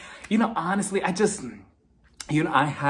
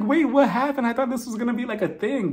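comically let-down, voice raised high; baffled; 9 semitones higher than usual. Retold or imitated. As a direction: protest in amused disbelief. Retold confusion at very short ride.

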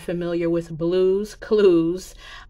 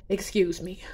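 real tearful whisper; soft apology while holding back tears; choked whisper; 5 dB quieter than usual. Real, not acted.

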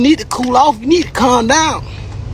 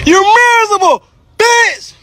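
jeering yell pitched up; sneering, raised voice taunt; high taunting yell; 10 semitones higher than usual. Real, not acted.

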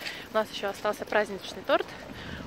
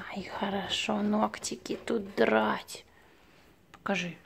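whisper; 5 dB quieter, much slower than usual. Real, not acted. Cooing to the cat.

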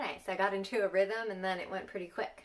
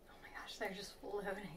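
whisper; 10 dB quieter than usual. Real, not acted. Whispers over floating baklava.

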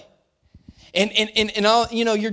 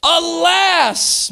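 cried out; 7 semitones higher, 4 dB louder than usual. A narrator's reading. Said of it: "he cried out and said".

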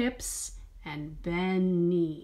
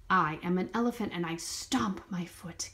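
whisper; 3 semitones lower, faster than usual. Pretend. Elephant line whispered.

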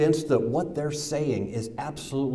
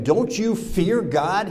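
rebuke; 7 semitones higher, 3 dB louder, slower than usual. Acted. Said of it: "the other criminal rebuked him".

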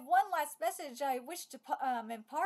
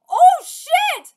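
sudden loud high curse; startled yell; 10 semitones higher, 19 dB louder than usual. Real, not acted. Gamer caught off guard by a monster, yelling. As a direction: blurt it out in real shock.

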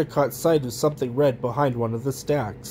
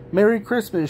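mild storybook shout, pitched up; 6 semitones higher than usual. A narrator's reading. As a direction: call out as the elves, not full volume. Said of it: "shouted the elves".

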